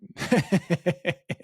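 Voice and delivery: loud, high burst of laughter; real laugh. Real, not acted. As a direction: laugh out at your own story. Laughs at own story.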